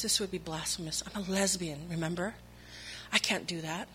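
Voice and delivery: soft, inward plea to God; hushed prayer. Retold or imitated. Speaker quietly praying to God during communion, hushed. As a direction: murmur it as a private confession.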